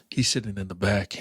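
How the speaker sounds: ordinary talk, nothing marked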